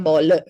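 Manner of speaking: "ball" ending in an extra uh; "ball" as two joined syllables, ball-uh; added schwa. Shown as a demonstration. Showing what the word becomes, intrusive schwa. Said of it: "you put an a there".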